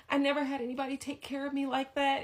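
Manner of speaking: high whimpering plea; mock whiny lover. The man to her mom, high mocking whine. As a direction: pitch him up into a pathetic whine.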